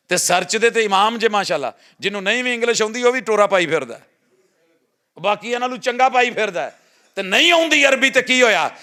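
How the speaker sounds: high, loud, urgent sermon delivery; preaching at full force. Acted. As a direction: preach it out to the whole congregation. (ਜੋਸ਼ੀਲਾ, ਉੱਚੀ ਆਵਾਜ਼ ਵਾਲਾ ਵਾਅਜ਼)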